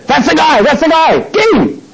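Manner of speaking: high-pitched urgent calling out; acted crowd alarm. Temple crowd spotting their man, acted high-pitched cry. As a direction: raise the alarm like an accusing mob.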